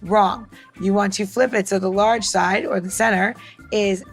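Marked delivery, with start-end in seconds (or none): none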